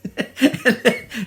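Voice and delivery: high, loud burst of real laughter. Real, not acted. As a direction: cracks up laughing at the memory. Laughs at own story.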